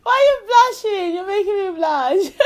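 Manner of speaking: high, loud, giggly teasing; laughing tease. Real, not acted. Mother teasing her blushing daughter, laughing high.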